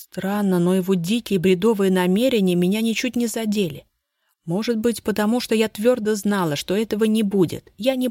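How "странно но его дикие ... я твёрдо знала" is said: usual speaking voice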